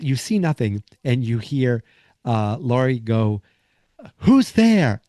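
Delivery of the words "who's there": high, loud challenge to an intruder; startled call. Retold or imitated. Laurie calling to whoever is in the dark, startled call. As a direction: call out sharply, much higher than usual.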